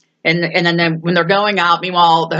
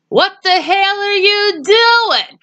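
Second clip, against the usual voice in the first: high, slow, outraged cleaning lady; cleaning lady yelling; 12 semitones higher, slower than usual. Retold or imitated. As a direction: shriek it like a startled cleaning lady. Cleaning lady yells at them.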